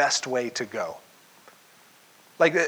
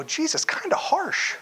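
wry reaction; 12 semitones higher than usual. Retold or imitated. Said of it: "I read that and I go".

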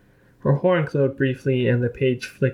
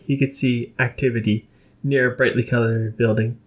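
ordinary delivery, nothing marked

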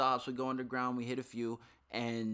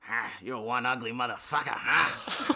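loud mock leering insult, rising to a louder finish; imitating abusive man; 8 dB louder, slower than usual. Storyteller mimics the man insulting a passenger, loud and abusive. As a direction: act out a brash heckler, punching the end. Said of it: "like, just like that".

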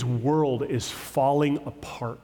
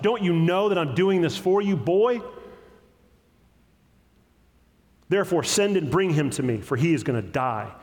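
rising, louder furious rebuke of his son; angry king; 6 semitones higher, 3 dB louder than usual. A narrator's reading. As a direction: build the fury up to the word boy, higher and louder. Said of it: "Saul's anger was kindled against Jonathan".

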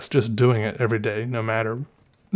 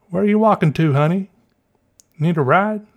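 put-on thick Southern accent; 7 semitones higher, 5 dB louder than usual. Ladies in a pickup offer a walker a ride, imitated Southern accent. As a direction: call out like a Southern lady. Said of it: "in a Southern accent like that".

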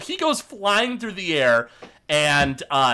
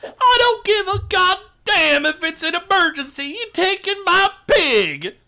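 high, hollered rant; outraged orc; 4 dB louder than usual. Acted. Orc to boar thief, outraged high-pitched holler. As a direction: yell it, dragging out the last words.

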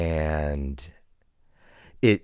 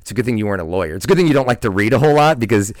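louder, fast teasing quote of his wife; 2 semitones higher, 8 dB louder, faster than usual. Retold or imitated. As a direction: rattle it off like a wife teasing him, loud. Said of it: "My wife likes to say now that".

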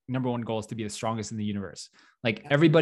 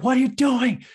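urgent yelling; 6 dB louder than usual. Retold or imitated. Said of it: "everyone was like".

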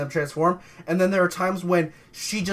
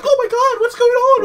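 high, loud, panicked mock gasp; mock panicked presenter; 7 dB louder than usual. Friends mocking the movie's presenter, shrill mock panic. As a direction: ham up the shocked news lady. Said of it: "Of just being like".

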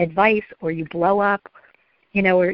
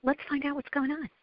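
softer, gently inquiring; calm reassurance; 6 semitones higher, 10 dB quieter than usual. Acted. Parent not overreacting to the child, soft and calm. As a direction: drop to a soft, reasonable voice. Models calm reply after panic.

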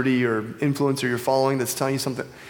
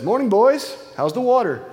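put-on higher, louder friendly greeting from an old fish; cheery old fish; 8 semitones higher, 4 dB louder than usual. Old fish greeting two young fish, higher and louder. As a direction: hail the young fish brightly, like a kindly elder. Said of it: "The older fish nods at them and says".

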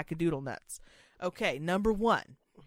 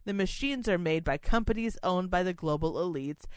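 normal talking voice

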